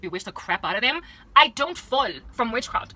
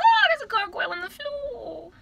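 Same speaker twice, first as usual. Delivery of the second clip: very high, drawn-out fake alarm; mock shock; 13 semitones higher than usual. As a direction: squeal it slowly in hammed-up horror. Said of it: "and I was like".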